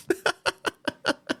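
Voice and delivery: real wheezy high laugh; high giggling laughter. Real, not acted. Storyteller laughing at himself, high giggles.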